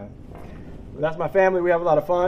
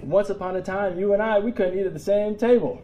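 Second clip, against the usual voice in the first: higher-pitched imitation of an excited son; excited child; 7 semitones higher than usual. Son telling dad what he learned at school, excited. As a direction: play an eager kid bringing home big news. Retold son's amazed discovery.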